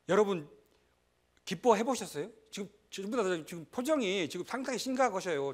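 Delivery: ordinary talk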